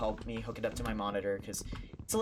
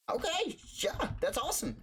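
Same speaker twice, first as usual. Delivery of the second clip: much higher, giddy delight; barely containing his joy; thrilled. Retold or imitated. Reacting to the clerk's six-dollar price, giddy.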